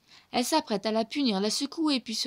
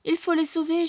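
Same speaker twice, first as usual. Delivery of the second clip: higher, louder panicked plea; wailing; 7 semitones higher, 4 dB louder than usual. A narrator's reading. Bernard moans that they must save them, higher. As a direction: moan with alarm. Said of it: "gémit Bernard".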